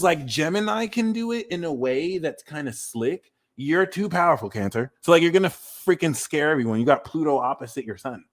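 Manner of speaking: ordinary delivery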